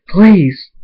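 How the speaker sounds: high, loud begging; pleading cry. A narrator's reading. Hunter begging his captor for mercy, pleading cry.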